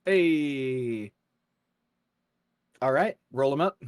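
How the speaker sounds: ordinary talk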